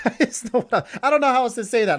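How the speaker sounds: high, loud, laughing protest. Real, not acted. Joking about his suggestive phrase.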